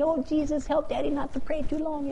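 put-on high, sweet child's prayer voice; imitating a small child. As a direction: pray like a little kid at bedtime. Little kids praying, imitated.

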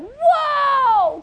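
loud, high astonished whoa; amazed whoa. Acted. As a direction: gasp it out as if you can't believe your eyes. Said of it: "everybody's like".

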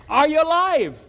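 high calling out to the pit; king calling down. Acted. King calls down into the den, high and eager. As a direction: call down into the den. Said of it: "shouts and said".

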